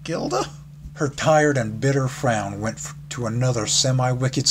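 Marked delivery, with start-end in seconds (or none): 0.0-0.5 a much higher, startled gasp of a name. Acted. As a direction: gasp her name in shock. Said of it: "I gasped when I saw it was Gilda".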